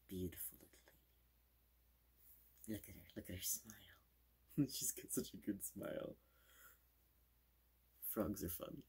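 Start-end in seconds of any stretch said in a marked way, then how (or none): 0.1-6.1 whispering. Acted. Whispered praise of plush.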